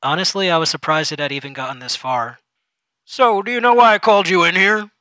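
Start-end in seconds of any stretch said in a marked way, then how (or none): 3.1-4.9 higher, louder chummy supervisor; patronising boss asking a loaded question. Acted. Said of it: "he asked".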